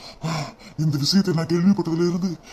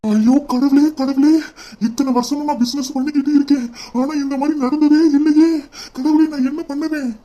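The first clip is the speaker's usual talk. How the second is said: higher, louder cries of despair to God; panicked wailing; 7 semitones higher, 3 dB louder than usual. Acted. Boss crying out to God over the fire, panicked wail.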